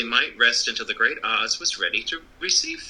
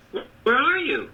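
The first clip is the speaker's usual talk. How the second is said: startled Dorothy; 13 semitones higher than usual. A narrator's reading. Dorothy calls to unseen voice.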